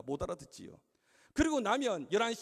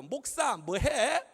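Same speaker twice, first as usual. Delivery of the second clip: higher, louder, drawn-out taunt; rude kid; 4 semitones higher, 6 dB louder, much slower than usual. Retold or imitated. Rude child addressing the pastor, mocking drawl. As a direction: imitate a cheeky child, stretching it out slowly. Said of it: "버릇없고 예의 없는".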